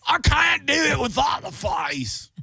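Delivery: very high, wobbly mock-British voice; hammed-up British accent. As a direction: squeal it in a bad British-Australian accent. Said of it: "make this voice".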